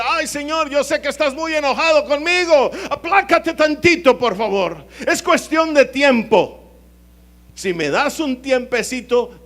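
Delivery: high, loud mock begging to God; pleading sinner. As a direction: beg the Lord for time, hammed up. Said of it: "Haz de cuenta que dice".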